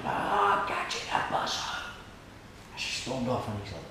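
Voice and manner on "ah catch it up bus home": garbled yell, imitating a woman storming off; retold angry shout. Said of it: "and she shouted".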